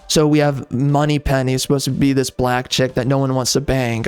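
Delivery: ordinary delivery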